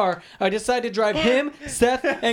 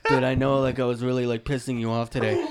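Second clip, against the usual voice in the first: lower, mock sheepish apology voice; fake apology; 7 semitones lower than usual. Friend mimicking Lucas's fake apology, lower and mock sorry. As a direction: imitate an insincere apology. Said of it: "give me this fake apology".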